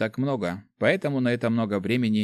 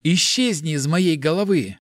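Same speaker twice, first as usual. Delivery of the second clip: higher, forceful outburst; exasperated cry, moderately raised; angry demand; 5 semitones higher, 4 dB louder than usual. A narrator's reading. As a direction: order the voice out. Mart yells at inner voice.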